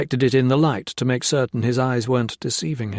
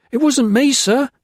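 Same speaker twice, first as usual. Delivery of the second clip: much higher, loud whine; whining; 13 semitones higher, 6 dB louder than usual. Acted. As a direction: whine like a caught boy. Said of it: "Blakely whined".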